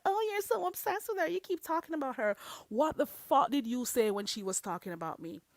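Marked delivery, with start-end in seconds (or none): 0.0-2.3 high, whiny mocking voice; mocking the viewer's accusation in a high whiny voice; mocking quote. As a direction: mimic the viewer's nagging in a much higher pitch. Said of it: "So don't tell me that".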